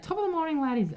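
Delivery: high-pitched mock Irish greeting; attempted Irish accent. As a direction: put on a hammy Irish accent. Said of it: "what do they say?".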